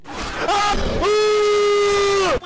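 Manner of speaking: loud, high shrieks; real frightened yelps; startled screams. Real, not acted. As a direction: scream at the jump scare.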